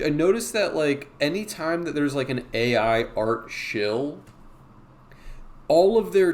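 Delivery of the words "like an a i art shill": ordinary talk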